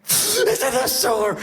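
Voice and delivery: high, wailing hammed-up despair; over-the-top weeping lament; mock sobbing mother. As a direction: wail like a hysterical mother. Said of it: "she's in tears".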